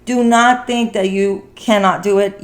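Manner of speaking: normal talking voice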